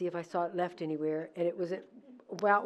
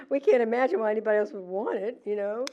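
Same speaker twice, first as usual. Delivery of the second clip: ordinary delivery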